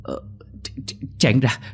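terrified stuttering plea growing to a yell; choked stutter. Acted. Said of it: "chỉ phát ra tiếng ú ớ".

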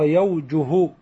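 يوجه slowly as yaw-ju-hu, w sounded; waw kept. Shown as a demonstration. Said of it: "ما حذفت الواو فيه". (يوجه بتمهل وإثبات الواو)

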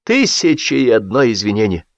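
ordinary delivery

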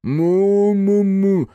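loud slow muffled moo; gagged mooing. A narrator's reading. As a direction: moan through a gag. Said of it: "промычал он в ответ".

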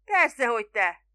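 loud, brisk answer; emphatic reply. A narrator's reading. Spiro answering the puzzled boatman, emphatically. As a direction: call back as if it were obvious. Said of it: "felelte Spiro".

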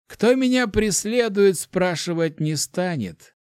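understated menace, drawn out; sinister amusement. A narrator's reading. Necromancer dismisses talk of his pursuers, darkly amused. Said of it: "как-то недобро хохотнул некромант".

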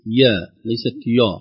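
usual speaking voice

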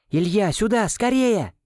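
high urgent calling out. A narrator's reading. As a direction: call him over in a hurry. Нонна зовёт Илью к машине. (срочный высокий оклик)

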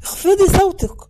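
urgent shouted rebuke; shouting back. A narrator's reading. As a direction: shout at a sister to shut her up. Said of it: "صاح فيها عيسى".

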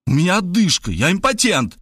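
high-pitched desperate protest; panicked squealed excuses. A narrator's reading. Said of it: "взвизгнул я". (отчаянные оправдания высоким голосом)

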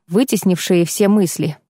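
ordinary delivery, nothing marked

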